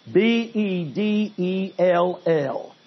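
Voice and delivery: each letter of B E D E L L said slowly and apart; spelled out slowly. Shown as a demonstration. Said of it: "I'll say it one more time".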